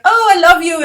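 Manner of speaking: put-on dying mother's loving farewell, Eastern European accent. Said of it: "in her Eastern European accent".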